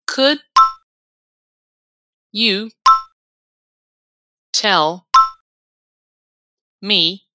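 isolated words with long pauses between; teacher's word-by-word repetition drill. Shown as a demonstration. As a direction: say each word on its own for learners to repeat. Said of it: "Vamos repetir palavra por palavra".

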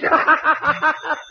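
big high chuckle at the paper; amused guffaw over the newspaper; hearty laugh. Acted. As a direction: burst out laughing at the joke.

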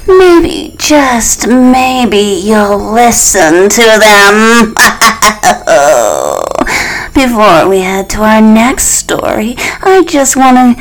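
loud, high cartoon monster host; little monster voice. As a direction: play a cheerful little monster. Monster host addresses children.

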